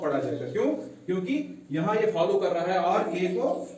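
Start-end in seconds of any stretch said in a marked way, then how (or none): none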